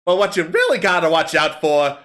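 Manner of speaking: bawdy kobold comic on stage; kobold comedian. Acted. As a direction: perform it like a street comic working a crowd. Kobold comic tells bawdy joke.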